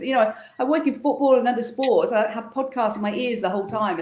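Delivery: usual speaking voice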